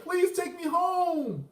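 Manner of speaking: high, slow, whiny mock plea; imitating a tearful plea. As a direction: drag it out like a begging girl. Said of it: "she started to cry".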